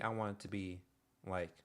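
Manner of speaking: ordinary delivery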